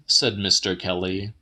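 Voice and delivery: usual speaking voice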